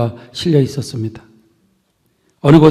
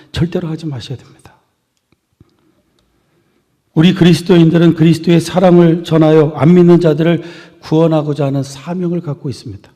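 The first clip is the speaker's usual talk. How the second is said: ordinary talk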